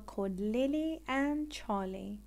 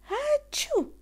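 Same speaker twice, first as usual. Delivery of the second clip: huge high explosive sneeze; loud sneeze; 8 dB louder than usual. Acted. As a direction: blast out a giant achoo. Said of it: "She has a loud sneeze".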